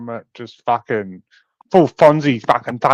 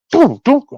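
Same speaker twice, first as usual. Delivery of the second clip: crash sound effect; 12 semitones higher, 7 dB louder than usual. Retold or imitated. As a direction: boom and dunk, high and loud, punchy. Imitating the crash noise.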